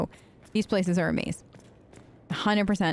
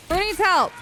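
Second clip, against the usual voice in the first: high, alarmed question; urgent call; 10 semitones higher, 4 dB louder than usual. Real, not acted. Player checking who is in trouble, high urgent call. Calls out during a fight.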